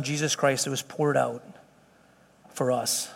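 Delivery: measured, softened public prayer; congregational prayer. Real, not acted. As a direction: lead the closing prayer, pausing between phrases. Leads closing prayer.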